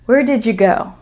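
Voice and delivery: "did you" blended into "didja"; connected speech. Shown as a demonstration. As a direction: say "did you" as one word with a J. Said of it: "did you turns into did ya".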